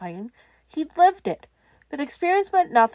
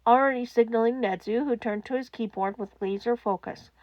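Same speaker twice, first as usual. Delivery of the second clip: ordinary delivery, nothing marked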